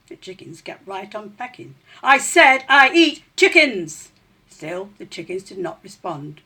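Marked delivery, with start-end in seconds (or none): 2.0-4.1 loud, slow, high shouted threat; indignant rat shout. A narrator's reading. Rat yelling at chickens who won't look up. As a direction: bellow each word, insulted at being ignored.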